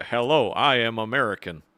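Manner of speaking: low, stilted robot-like American accent; American accent with robotic delivery. Shown as a demonstration. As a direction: attempt a neutral American and sound like a robot. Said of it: "you sound like a robot".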